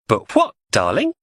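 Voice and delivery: sugary menace; sweet on the surface, dangerous under; falsely cheery. Acted. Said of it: "That cheery, everything in the garden's just peachy tone".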